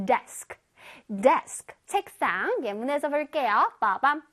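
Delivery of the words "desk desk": "desk" hit firmly as one strong beat; whole word stressed. Shown as a demonstration. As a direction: give all of "desk" a strong, even punch. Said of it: "단어 전체에 강세가 들어가서 강하게 발음해줄게요".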